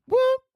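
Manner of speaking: short, loud high whoop; a quick squealed "whoop". Pretend. Host excited about [name], high whoop.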